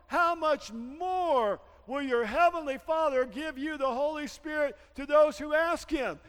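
shouted, high preaching; full-throated sermon climax; preaching at full force. Acted. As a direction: preach it out, loud and slow.

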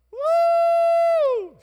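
piercing high whoop; imitating a church whoop. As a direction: copy the congregation's wild woo. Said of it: "They're like".

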